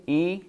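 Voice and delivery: "E" said as a long ee, as in machine; long I sound. Shown as a demonstration. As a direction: hold "E" out as a clear ee. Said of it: "It has a long one".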